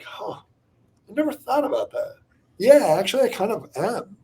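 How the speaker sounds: high, musing admission; retold moment of self-discovery; dawning realization. Realizing it as he answers.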